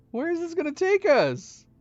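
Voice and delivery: high, loud startled cry; startled outcry. Real, not acted. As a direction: blurt it out in shock. Startled by new puzzle box.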